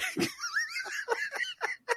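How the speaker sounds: high, wheezing laugh; laughing fit. Real, not acted. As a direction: crack up helplessly, squeaking high. Cracking up at own retold quote.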